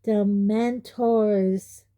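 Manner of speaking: very slow, each syllable clear; slowly and clearly. Acted. Harry spelling out the word for his uncle, very slow and clear. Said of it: "said Harry slowly and clearly".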